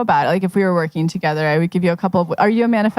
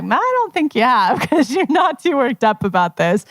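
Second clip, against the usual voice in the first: amused higher voice, laughing through it; teasing her with a laugh; playful laughing doubt; laughing tease; 5 semitones higher than usual. Retold or imitated. Coach teasing client, laughing.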